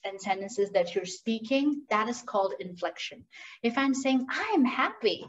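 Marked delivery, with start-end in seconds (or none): 4.3-5.2 bright, lifted I'm happy; happy-sounding I'm happy; with emotion. Shown as a demonstration. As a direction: say it like you mean it, with feeling. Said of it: "when I put my emotion into it and don't sound robotic".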